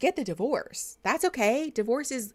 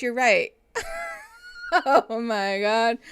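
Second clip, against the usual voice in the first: ordinary talk, nothing marked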